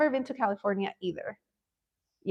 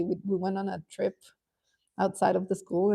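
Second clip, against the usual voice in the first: normal talking voice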